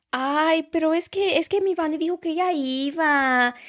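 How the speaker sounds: high whiny imitation of mom; mimicking mom. Daughter mimics mom's excuse about Vani, high and whiny.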